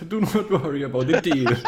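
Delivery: put-on loud, theatrical old dealer; old necromancer. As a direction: reassure grandly as a necromancer. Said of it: "every time you talk as this necromancer".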